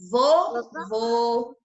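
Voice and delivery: "vovô" said slowly with a closed "ô"; closed vowel. Shown as a demonstration. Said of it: "a vogal tem o som fechado". ("vovô" pausado, com som fechado)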